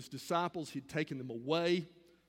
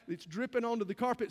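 ordinary talk

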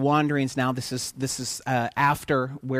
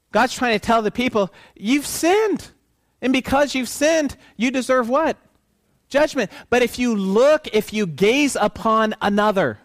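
raised, higher voice, warning hard; preaching at full force; 8 semitones higher, 5 dB louder than usual. Acted. Preacher thunders God's warning to the people, loud and high. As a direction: hammer home the warning of judgment.

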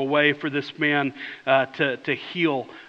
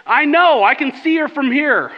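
much higher, louder; annoyed shout; 9 semitones higher, 7 dB louder than usual. Retold or imitated. Father shouting back from the restaurant door, annoyed shout. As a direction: yell back, irritated. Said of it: "And he said".

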